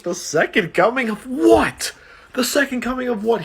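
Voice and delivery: high-pitched mock disbelief; retold reaction, hammed-up astonishment; incredulous. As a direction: squawk it back as a baffled question. Retold baffled reply to the driver.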